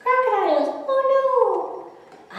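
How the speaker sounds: high, loud squeaky little duckling panic; scared ducklings. A narrator's reading. Ducklings refuse to follow mommy into the water, in high panicked squeaks. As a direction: squeal it like frightened baby ducks. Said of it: "and they're saying".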